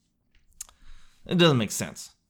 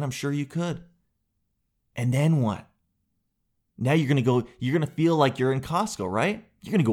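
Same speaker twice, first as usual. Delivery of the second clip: normal talking voice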